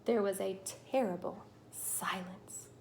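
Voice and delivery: hushed storyteller whisper; a dramatic whispered pause. A narrator's reading. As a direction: breathe it out in suspense. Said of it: "unvoiced whisper".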